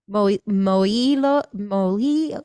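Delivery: sounding out "moilo" haltingly, stretched and slow; stumbling pronunciation. Real, not acted. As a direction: trip over "moilo" in slow, unsure attempts. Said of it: "I'm having problems saying that".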